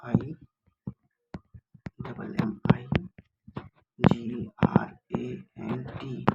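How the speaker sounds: very slow, letter by letter, each letter separate; spelling aloud. Shown as a demonstration. Teacher spells word for students, slow and deliberate. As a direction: spell it out so students can write it down.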